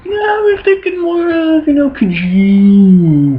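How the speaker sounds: very high, slow, drawn-out mom voice; mock a mother spelling it out sarcastically; high sarcastic mom hinting at a miracle; high mocking Mary voice. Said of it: "She's like".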